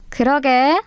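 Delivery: ordinary delivery, nothing marked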